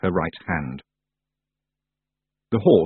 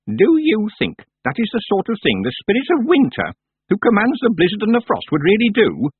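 put-on stern old witch; 12 semitones higher, 4 dB louder than usual. Miss Treason questioning.

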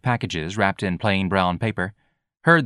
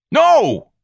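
very high, loud outburst; furious scream; 9 dB louder than usual. A narrator's reading. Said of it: "Ignatius screamed so furiously".

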